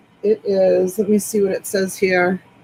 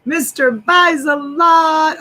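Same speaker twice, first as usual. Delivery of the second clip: drawn-out, louder welcome; excited greeting; 6 semitones higher, 4 dB louder, slower than usual. Real, not acted. Host greeting the visitor Mister Buys a Lot, slow and delighted. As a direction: stretch the name out, delighted.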